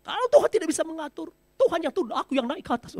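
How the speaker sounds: high, loud and fast boasting; arrogant demand. Acted. Preacher mocks an arrogant believer, high and loud. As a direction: swagger over God. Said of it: "udah berlebihan itu".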